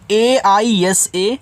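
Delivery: letters spelled out one by one, very slowly; slow letter-by-letter spelling. Shown as a demonstration. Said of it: "ऐसा".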